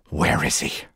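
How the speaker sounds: low confrontational question; low-pitched accusing wife; menacing demand. Acted. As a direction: demand it darkly. Swaying wife confronts her rival.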